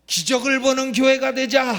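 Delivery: full-voiced, high congregational shout; rallying shout. Real, not acted. Preacher leading the congregation in a slogan, real loud shout. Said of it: "한번 주먹 내고 소리 지르시죠".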